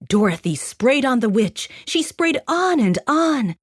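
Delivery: ordinary delivery, nothing marked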